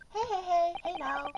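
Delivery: usual speaking voice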